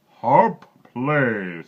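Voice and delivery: loud, high booming giant voice; giant's command. A narrator's reading. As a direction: call out to the harp like a giant. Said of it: "said the giant".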